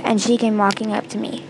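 ordinary delivery, nothing marked

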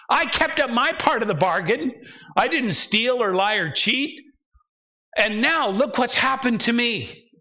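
acted complaint, insisting on a fair deal; ticked off at God. Believer protesting a broken bargain to God, ticked off. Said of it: "That's when I get ticked off at God".

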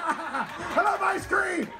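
high, slow mock-kid yell; hammed-up childish cheer, drawn out; excited kid outburst. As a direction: shout it high like an overexcited child. Acted kid bursting out.

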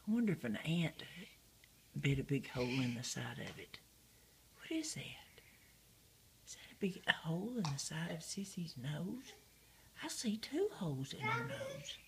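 soft unvoiced whisper. Real, not acted. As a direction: hush so Sissy keeps sleeping. Whispering about sleeping Sissy.

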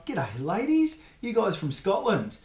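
a bloke trying his luck at the bar; cheeky pub pickup line. Retold or imitated. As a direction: sidle up and charm the ladies. Chatting up women at the pub.

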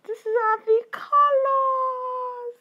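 high squealing delight; excited squeal. Real, not acted. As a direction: squeal it out, thrilled. Squealing at palette colors.

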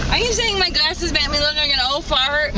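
high, fast mock outrage; mock indignant. Real, not acted. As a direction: play offended, laughing.

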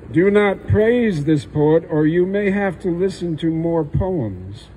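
usual speaking voice

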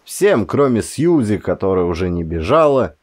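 ordinary delivery

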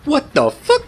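high squeaky outraged what the fuck; rising incredulous squawk; shocked. Real, not acted. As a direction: squeal it in disbelief. Shocked outburst at scene.

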